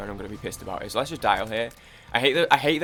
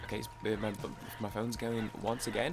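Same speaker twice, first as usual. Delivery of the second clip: ordinary talk, nothing marked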